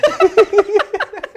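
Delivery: bursts out laughing, high and loud. Real, not acted. Laughs at his own joke.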